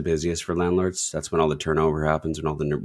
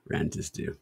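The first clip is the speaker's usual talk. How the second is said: breathy whisper, cold and stingy; cold greedy landlord; 9 dB quieter than usual. Pretend. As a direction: hiss it like a miserly landlord. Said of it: "Now I sound like Ebenezer".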